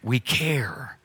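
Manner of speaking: softly whispered aside. Real, not acted. Pastor telling kids parents care, whispered. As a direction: drop to a hushed whisper.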